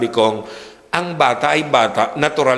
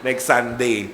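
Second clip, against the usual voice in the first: normal talking voice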